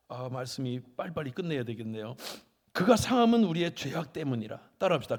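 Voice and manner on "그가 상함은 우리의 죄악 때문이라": loud, firm verse declaration; emphatic proclamation. Real, not acted. Preacher giving congregation the verse, loud proclamation. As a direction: proclaim it loudly, falling at the end.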